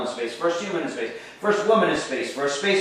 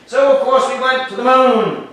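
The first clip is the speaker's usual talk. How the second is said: louder and higher proud declaration; triumphant; 7 semitones higher, 8 dB louder than usual. Acted. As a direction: announce it like a victory. Triumphant punchline to class.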